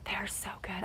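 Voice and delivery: whispered aside; hushed, unvoiced delight. Real, not acted. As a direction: breathe it like a secret. Whispered aside about donuts.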